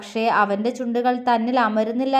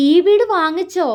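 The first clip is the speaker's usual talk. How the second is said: higher, louder astonished question; surprised; 6 semitones higher, 5 dB louder than usual. Acted. Aishu asks Mithu about the house, surprised. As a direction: she can't believe he bought the house. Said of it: "അത്ഭുതത്തോടെ ചോദിച്ചു".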